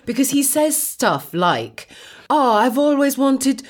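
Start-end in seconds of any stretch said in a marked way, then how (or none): 2.3-3.6 Super Mario Italian. Retold or imitated. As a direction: put on a ridiculous stage-Italian voice. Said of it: "this is literally how he talks".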